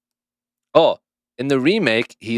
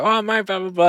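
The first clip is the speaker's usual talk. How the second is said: high gabbling imitation of [name]; mock [name] babble; 11 semitones higher, 3 dB louder than usual. Lightly mocking the singer chatting to a Japanese fan. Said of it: "[name]'s just like".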